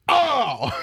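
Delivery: high, loud shocked yelp; startled cry. Retold or imitated. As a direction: yelp as you crash onto the bed. Roommate hits flipped bed.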